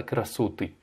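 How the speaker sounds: красоты as "kra-SO-ty", stressed middle o; wrong-stress example. Shown as a demonstration. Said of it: "pas sur l'avant-dernière syllabe". (красоты accentué à tort sur « so »)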